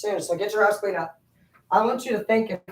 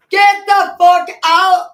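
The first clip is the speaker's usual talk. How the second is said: slow, loud, high yell; imitating God yelling at him to leave; 10 semitones higher, 12 dB louder, much slower than usual. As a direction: drag out each word as a booming shout. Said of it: "he, like, yells at me".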